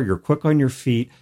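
ordinary talk, nothing marked

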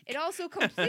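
cracking up mid-sentence; words breaking into laughter; laughing. Real, not acted. Reader cracking up at the silly story.

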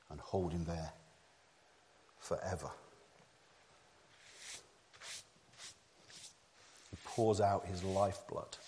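low, soft telling with long pauses; hushed solemn preaching. Real, not acted. Preacher tells of death taking Jesus, hushed and solemn. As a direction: let the voice sink right down.

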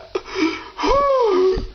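hammed-up crying exhale; high tearful sigh. Dater overcome with emotion on video, sobbing sigh. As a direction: let out a high, weepy sigh.

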